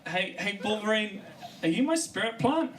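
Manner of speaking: excited high plea to the kelp; eager calling out. Acted. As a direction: call out like a smitten fan. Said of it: "narrator eagerly calls to kelp".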